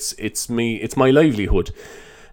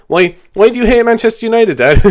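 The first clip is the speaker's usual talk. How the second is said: put-on kid voice; 10 semitones higher, 5 dB louder than usual. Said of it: "My kids will be like".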